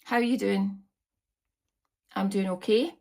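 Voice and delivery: ordinary talk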